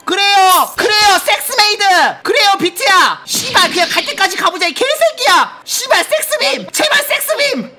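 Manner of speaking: loud shouts climbing high into pleading; frenzied cheering. Real, not acted. Trader urging Bitcoin all the way up, frenzied. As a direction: build from loud urging to high screamed begging.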